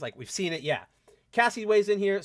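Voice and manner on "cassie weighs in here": ordinary talk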